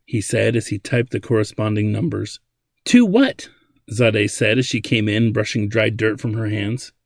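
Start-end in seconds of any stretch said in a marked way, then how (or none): 2.9-3.5 sharp high startled question; surprised. A narrator's reading. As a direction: cut in with sudden surprise. Surprised woman bursting in.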